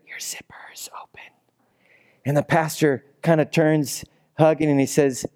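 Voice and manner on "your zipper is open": hushed whisper in his ear; whispering a secret warning. Retold or imitated. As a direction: breathe it quietly into his ear. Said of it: "he whispers into his ear".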